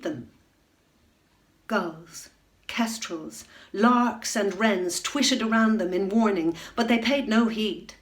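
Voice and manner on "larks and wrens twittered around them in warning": usual speaking voice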